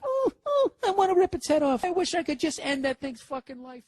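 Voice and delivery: very high, whiny squeal of a dog; crying dog's voice. Retold or imitated. Dog crying at a squirrel, squealed very high. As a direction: whimper it like a frustrated dog at the window. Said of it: "Is it crying like".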